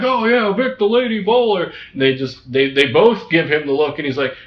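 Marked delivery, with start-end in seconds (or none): 0.0-1.7 higher, eager know-it-all; mock clueless fan, pitched up; clueless guy voice. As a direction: say it proudly, totally wrong. Gordo's clueless sports remark.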